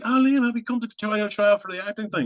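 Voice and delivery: a hint of a high girl's voice; lightly voiced Irish girl. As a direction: lightly mimic the eager director. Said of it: "And she was like".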